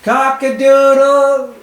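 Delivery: slow, loud, high crowing cock-a-doodle; rooster crow. A narrator's reading.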